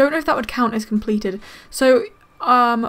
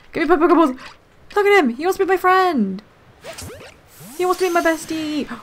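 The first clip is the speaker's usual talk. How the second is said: gushing excitement; 5 semitones higher than usual. Real, not acted. Pokémon wants to be friends.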